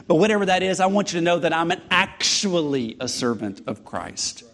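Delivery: ordinary talk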